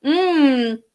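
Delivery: ordinary talk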